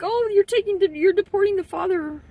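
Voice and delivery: high-pitched mock wail; mock crying. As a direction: whine it like a mocking crybaby. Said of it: "they'll be crying like".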